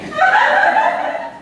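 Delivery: high scornful cackle; mocking laugh. Diva mocking the guest, high scornful cackle. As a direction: laugh her down.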